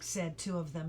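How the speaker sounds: usual speaking voice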